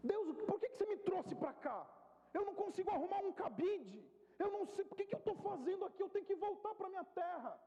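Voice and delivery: high-pitched, grumbling protest to God; grumbling complaint. Retold or imitated. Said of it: "E comecei a murmurar".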